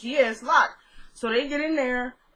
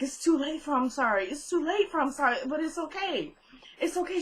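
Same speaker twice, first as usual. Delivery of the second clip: higher-pitched, taunting reassurance; mock soothing; 5 semitones higher, 4 dB quieter than usual. Attacker answers her apology with it's okay, mock soothing. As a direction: mimic a taunting man, softening into high mock comfort.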